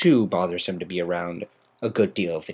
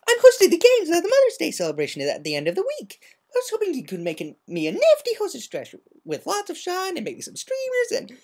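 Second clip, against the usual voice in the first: much higher, quick chirpy party pony; bubbly Pinkie Pie. Acted. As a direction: rattle it off, giddy and playful. Pinkie Pie rambles excitedly.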